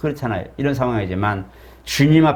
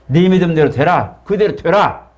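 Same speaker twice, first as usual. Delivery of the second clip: loud, raised-pitch decree; authoritative pronouncement; 4 semitones higher, 6 dB louder than usual. Acted. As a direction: proclaim it firmly, higher on the repeat. Acted pronouncement of Jesus granting healing. (힘차게 선포하듯)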